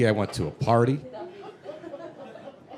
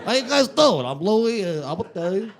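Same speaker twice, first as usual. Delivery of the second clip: put-on old Italian guy voice; 4 semitones higher, much slower than usual. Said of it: "he'd be like".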